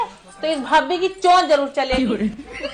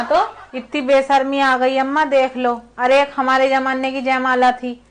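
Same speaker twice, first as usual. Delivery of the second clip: ordinary talk, nothing marked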